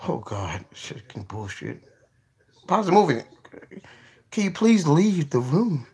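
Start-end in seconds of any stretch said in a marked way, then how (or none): 0.1-1.8 whispered groan. Real, not acted. Whispered exasperation.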